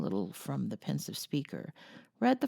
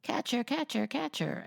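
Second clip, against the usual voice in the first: parrot calling out; 7 semitones higher than usual. Acted. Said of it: "calling out".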